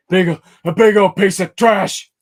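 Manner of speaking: loud, slow and high outburst; mock outburst. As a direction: bellow it out like a sports announcer. Exaggerated outburst.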